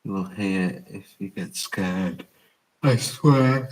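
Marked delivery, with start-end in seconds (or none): 2.8-3.7 said through a yawn. Real, not acted. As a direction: stretch it out mid-yawn. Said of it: "Sorry, I'm yawning".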